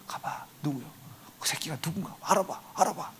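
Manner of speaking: hushed, urgent whisper. Retold or imitated. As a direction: egg him on under your breath. Peter nudging John secretly.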